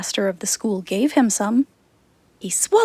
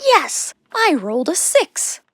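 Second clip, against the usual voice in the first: excited goat; 6 semitones higher than usual. A narrator's reading. Goat cheering during game.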